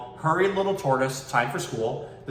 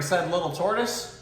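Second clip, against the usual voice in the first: plain reading voice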